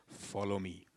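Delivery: "Follow me" low, quiet and firm; stern command. Retold or imitated. Low quiet stern order. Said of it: "looked at me sternly and said".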